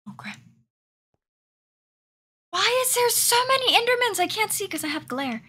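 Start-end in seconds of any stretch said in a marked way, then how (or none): 2.5-5.4 high, loud alarmed outcry; startled loud complaint; alarmed shout. Real, not acted. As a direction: yell it out in alarm at the monsters. Startled by many Endermen.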